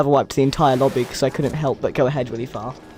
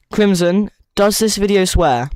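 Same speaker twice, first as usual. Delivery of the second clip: raised voice calling to a friend; 5 semitones higher, 5 dB louder than usual. Real, not acted. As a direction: call out across the room.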